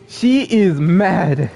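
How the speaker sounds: "She is mad" drawn out slowly, each word weighted; slow emphasis. Real, not acted. Streamer reacting to an angry game character, "She is mad" said slowly and emphatically.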